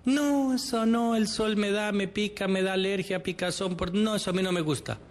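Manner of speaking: mucho más agudo y fuerte, quejica; excusas caprichosas. Retold or imitated. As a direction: lloriquea cada excusa como un malcriado. Imita las excusas del otro. (imitando a quien pone pretextos para no caminar)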